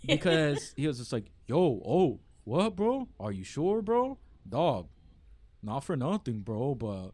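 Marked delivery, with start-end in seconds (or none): 1.5-7.1 doubting, cocky tone. Retold or imitated. Mimicking H2O doubting Hialeah can compete, mocking incredulous tone. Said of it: "I don't appreciate the tonality in his voice".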